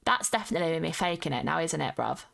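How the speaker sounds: hammed-up London bruv accent; fake London accent. Shown as a demonstration. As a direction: lay on a cheeky London accent. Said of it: "if I go into a little bit more of a voice".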